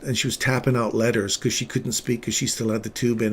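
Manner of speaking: usual speaking voice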